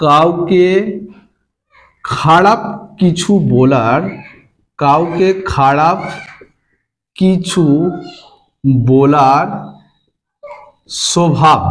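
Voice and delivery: word by word with long pauses; slow dictation. Shown as a demonstration. Teacher giving students the meaning, slow dictation. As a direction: dictate it slowly, leaving gaps for writing.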